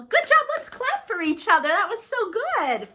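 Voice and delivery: high bright cheer; excited praise. Real, not acted. Teacher cheering the kids after their song, excited.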